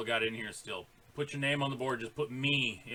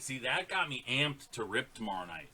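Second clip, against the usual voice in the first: normal talking voice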